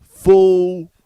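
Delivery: loud, high show title bellow; announcer shout. Pretend. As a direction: yell it like a promo.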